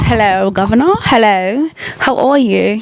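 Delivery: playful mock British greeting; cheeky amateur British accent impersonation. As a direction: put on a chirpy, hammed-up British accent. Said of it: "start talking in a British accent".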